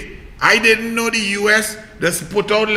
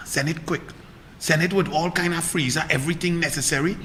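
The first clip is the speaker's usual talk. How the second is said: much lower and softer, confiding; hushed plea; 6 semitones lower, 12 dB quieter than usual. Real, not acted. Coaxing the USA to send Pfizer, hushed confiding plea. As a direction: draw them close and plead quietly.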